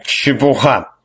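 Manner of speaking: put-on indignant drunk scoff. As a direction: scoff it out, outraged. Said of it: "возмутился жрец".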